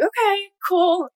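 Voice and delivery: slow, high mock casual okay; parents' casual okay. Parents reacting to her news, slow and high. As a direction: drawl it out like an unbothered parent. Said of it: "they're like".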